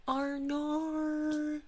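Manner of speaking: high, drawn-out mock Australian twang; bad Australian accent. Streamer shows the worst British accent, hammed-up Australian. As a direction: ham up a terrible Australian accent. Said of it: "worst British accent is an Australian".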